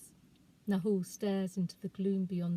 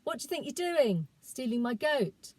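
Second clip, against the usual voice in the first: put-on accusing boy; 5 semitones higher, 4 dB louder than usual. Boy accuses girl of theft.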